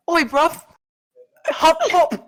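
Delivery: high, airy mock Aussie voice; mock Australian kangaroo. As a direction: squeak it out like a cartoon Aussie kangaroo. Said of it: "it's like a high-pitched, airy voice".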